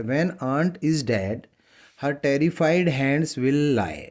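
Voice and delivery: drawn-out reading of a poem line; slow poem recitation. Shown as a demonstration. Teacher reading a poem line to students, slow recitation. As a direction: read each word very slowly for the class.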